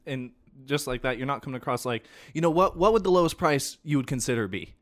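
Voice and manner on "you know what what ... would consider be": louder, higher, with a pushy edge; pushy loud question. Shown as a demonstration. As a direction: ask it with louder pushy insistence. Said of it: "the last one is the worst voice".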